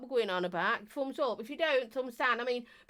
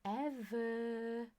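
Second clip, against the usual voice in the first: very soft, hushed and drawn-out "ever"; hushed, whispery swoon; 8 dB quieter, slower than usual. Shown as a demonstration. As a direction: drop right down to a whispery murmur. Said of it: "She says in a whispery voice".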